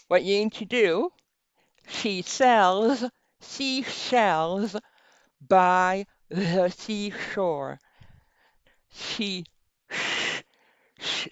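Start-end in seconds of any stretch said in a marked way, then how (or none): 1.9-11.3 slow tongue twister around a pen; pen in teeth. Shown as a demonstration. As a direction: enunciate slowly with a pen in the teeth. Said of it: "Put it at the limit of your smile".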